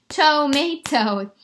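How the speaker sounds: "Tomato" slow and loud, TOE, MAY, TOE; split into syllables. Shown as a demonstration. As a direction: pound out "Tomato" in three strong beats. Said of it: "Three syllables".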